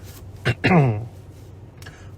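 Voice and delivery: grand, self-important throat clear; impressive throat clearing. Acted. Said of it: "coughed in an impressive way".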